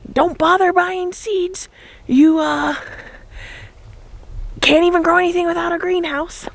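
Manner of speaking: lightly mocking know-it-all; mocking naysayer.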